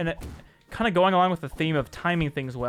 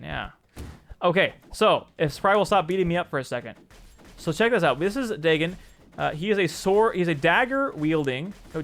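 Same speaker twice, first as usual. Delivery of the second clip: ordinary talk, nothing marked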